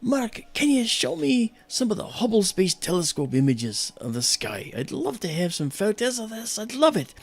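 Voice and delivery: high-pitched, eager retold voice; friend's eager request. Nun friend asking to see Hubble pictures, high eager voice. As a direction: voice her keen request, pitched well up.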